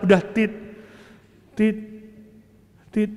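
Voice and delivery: spaced imitated monitor beeps. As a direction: tick off the beeps one by one. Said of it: "Itu nada detak jantung".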